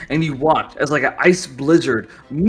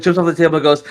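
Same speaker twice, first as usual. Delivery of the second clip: ordinary delivery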